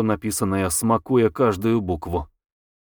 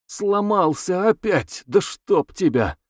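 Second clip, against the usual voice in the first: slow, high-pitched exasperated cursing; drawn-out frustrated outburst; 8 semitones higher, slower than usual. Acted. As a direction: curse the broken machine, drawing words out. Acted outburst at broken shuttle.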